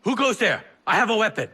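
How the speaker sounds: loud, high alarmed call into the dark; alarmed cry of a startled merchant; startled shout. Acted. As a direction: shout out, spooked and bluffing.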